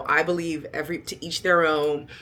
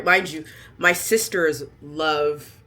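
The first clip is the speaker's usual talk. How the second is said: usual speaking voice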